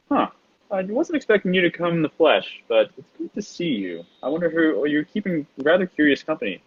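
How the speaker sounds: high, amused villain voice; a sly fox-like woman greeting an old rival; Night Fox voice. Acted. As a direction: purr it out, pleased and a little mocking. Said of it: "you guys recognize this voice as the voice of the Night Fox".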